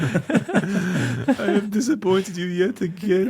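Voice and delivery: put-on Yiddish accent. Mocks Brandon's tearful apology.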